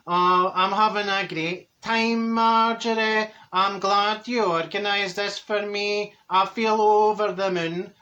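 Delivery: very slow, drawn-out friend impression; slow-talking Dorothy. Friend thanks Marjorie for organising party, slow imitated drawl. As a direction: imitate her thanks with long stretched words. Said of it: "So she said to me".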